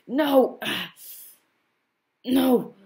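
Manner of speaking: high, loud anguished No; anguished cry. Acted. Fallen hero cries out in horror, loud and high.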